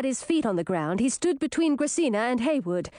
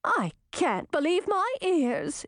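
high, indignant princess; high-pitched outrage; exclaimed disbelief; 8 semitones higher, slower than usual. A narrator's reading. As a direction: exclaim in offended astonishment. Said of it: "exclaimed Chartreuse".

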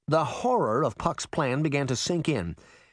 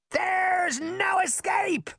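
very high, drawn-out gloating shout; villainous shout; much slower than usual. A narrator's reading. Trickster boy taunts the girls from his flying horse, shouting. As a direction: stretch it out like a boy tyrant calling from above. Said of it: "Puck shouted to the girls".

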